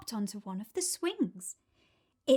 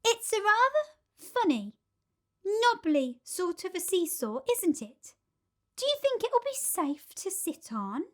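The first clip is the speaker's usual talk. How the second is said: put-on doubtful older girl; 9 semitones higher than usual. A narrator's reading.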